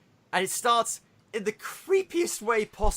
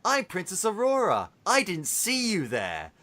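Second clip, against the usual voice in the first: dreamy preschool princess. Retold or imitated. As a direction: talk to toddlers like a dreamy cartoon princess, making fun of it. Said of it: "They talk in this really dreamy".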